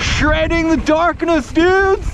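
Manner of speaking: high, loud hyped yelling; excited shouting. Real, not acted. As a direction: hype it up loud. Calling out while riding.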